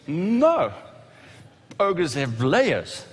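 a fed-up ogre spelling it out; Shrek impression. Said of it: "He goes".

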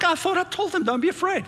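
high-pitched mock bafflement; baffled, squeaking it up high; puzzled God. God complaining to the angels, comically puzzled.

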